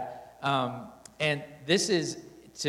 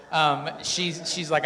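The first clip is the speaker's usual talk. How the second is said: ordinary talk, nothing marked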